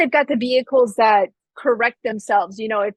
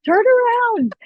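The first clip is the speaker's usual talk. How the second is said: urgent warning; 12 semitones higher than usual. Retold or imitated. Friend warning the rider about the car, urgent high call. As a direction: warn her to turn around now. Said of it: "she's like".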